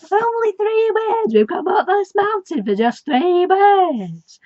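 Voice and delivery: high, loud incredulous squawk; Jericho's outrage. Retold or imitated. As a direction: play Jericho, indignant after the long climb. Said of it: "and Jericho's".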